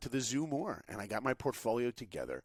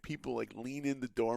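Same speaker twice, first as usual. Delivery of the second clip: normal talking voice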